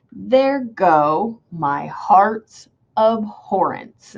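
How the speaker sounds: "there go my heart's abhorrence" slowly, high and low by turns; iambic scansion. Shown as a demonstration. Teacher letting students hear the meter, slow exaggerated beats. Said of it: "it sounds kinda high and then low".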